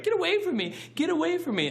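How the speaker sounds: put-on very high-pitched kid shriek; yelling little kid. Tiny kid yelling at the teenager in the street, high shriek. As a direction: imitate a small child yelling for help. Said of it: "So he started yelling".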